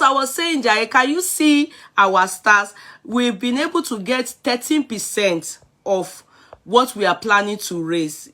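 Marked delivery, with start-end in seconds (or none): none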